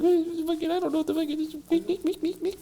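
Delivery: high squeaky confused voice; baffled squeal. Retold or imitated. Ex-con baffled by his first smartphone, squeaking. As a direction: squeak like the phone beats you.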